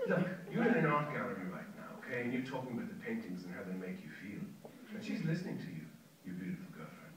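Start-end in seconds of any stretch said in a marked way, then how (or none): 2.9-7.1 softer, intimate warning; quiet telling-off. Acted. Old man warning the young stranger, softer. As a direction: lean in and warn him gently.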